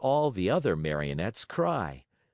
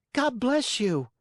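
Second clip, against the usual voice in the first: put-on much higher, a small wooden boy's voice; little Pinocchio; 4 dB louder than usual. A narrator's reading. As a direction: chirp it like a polite puppet.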